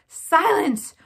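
head mouse roaring for order. A narrator's reading. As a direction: roar it to silence the room. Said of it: "roared the very honored head mouse".

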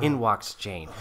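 low, even storyteller voice; story narration. A narrator's reading. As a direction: set the scene like a narrator. Narrator resumes.

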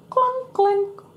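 high, mechanical clunk clink; clunking ski lift. A narrator's reading. Narrator mimics the ski lift clanking. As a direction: sound out the lift machinery.

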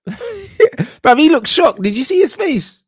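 very high, loud laughing shriek; laughing disbelief. Real, not acted. Laughing to the viewers about the keeper's face, high and loud. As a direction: crack up in shock.

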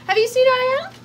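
high, loud question from someone searching; imagined searcher asking. Acted. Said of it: "You'll be like".

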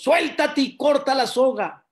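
high, loud acted order; insistent command. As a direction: order the climber to let go. God orders frightened climber. (orden a gritos)

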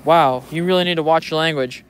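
ordinary delivery

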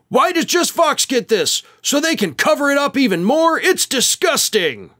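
high, indignant mock outrage; lightly hammed-up Mike Lindell, slowing on disgusting; Mike Lindell impression. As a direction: whine it like an aggrieved pillow salesman. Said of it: "My Mike Lindell impression".

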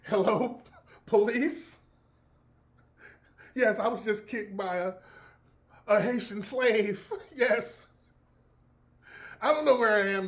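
mock a lost actor calling the police; imitating a confused actor on the phone. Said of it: "in a French costume on the phone".

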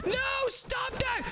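very high desperate cry; shrieked, frantic protest; panicked scream. Acted. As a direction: scream to make them stop. Dad destroying the TV.

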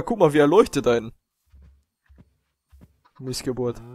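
usual speaking voice

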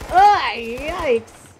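loud startled wince; real startled cry; loud yikes. Real, not acted. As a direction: blurt it out in alarm. Gamer reacts to danger.

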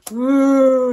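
loud, high drawn-out playful "ooh"; mock scary noise. Teacher showing children an alligator, mock scary noise. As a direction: let out a big pretend-scary "rooo".

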